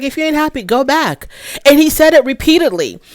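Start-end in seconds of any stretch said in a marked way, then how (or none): 0.0-1.3 higher-pitched mock of the husband telling her off; lightly voiced husband, dismissive; imitating husband. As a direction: toss it off like the fed-up husband. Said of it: "He was like".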